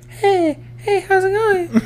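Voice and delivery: squeaky falsetto imitation of an uncle; very high, loud put-on greeting; high-pitched voice. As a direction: push it way up into falsetto. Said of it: "like, a high-pitched voice".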